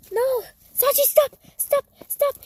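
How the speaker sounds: sharp loud high stop; loud scolding. Real, not acted. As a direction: yell at the dog to stop.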